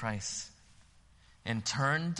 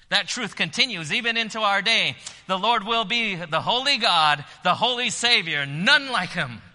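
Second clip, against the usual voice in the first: preaching at full force; 8 semitones higher, 11 dB louder than usual. Acted. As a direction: lift the whole room with the invitation.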